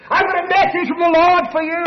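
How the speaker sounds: mock booming announcement; booming evangelist. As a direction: announce it like a big revivalist. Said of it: "in comes Herbert".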